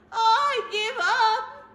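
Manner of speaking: loud, high, slow wail; blubbering. Acted. Bear gives up and blubbers on the floor. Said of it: "he blubbered".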